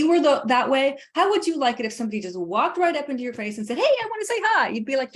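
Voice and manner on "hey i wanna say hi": much higher, mock overly eager greeting; intrusive stranger. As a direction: play the pushy stranger, bright and high. Stranger walking up to your face.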